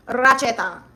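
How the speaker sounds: Racheta said like "ratchet" with an A at the end, RAT-che-ta; correct pronunciation. Shown as a demonstration. Correcting chat on her name, saying Racheta like ratchet plus A. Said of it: "Think ratchet with an A at the end".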